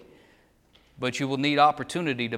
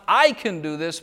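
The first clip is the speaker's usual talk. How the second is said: "I" punched louder at the start of "I can do this"; stress on I; 5 dB louder than usual. Shown as a demonstration. Preacher showing the wrong emphasis, stressing "I". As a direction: hit the word "I" hard. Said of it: "the emphasis is upon".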